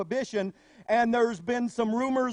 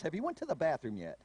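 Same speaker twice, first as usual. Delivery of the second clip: lower, much softer, quick mom; mother's stock reply; 6 semitones lower, 8 dB quieter, faster than usual. Retold or imitated. Mother brushing off her child's headache, low and quick. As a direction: rattle it off like a mother.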